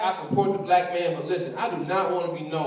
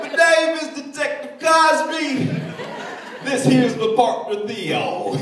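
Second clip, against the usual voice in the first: high, slow put-on Cosby voice; a cheery, drawn-out Cosby greeting; Bill Cosby impression; 6 semitones higher, 3 dB louder, slower than usual. Comic imagining Cosby as detective, high slow impression. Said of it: "he's like".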